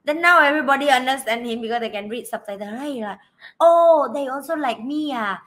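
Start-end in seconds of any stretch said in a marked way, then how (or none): none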